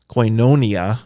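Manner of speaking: koinonia as koy-NOH-nia, long stressed o; alternative pronunciation. Retold or imitated. Teacher showing how someone might say it, alternative pronunciation. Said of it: "as someone might say it".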